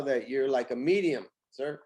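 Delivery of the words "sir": usual speaking voice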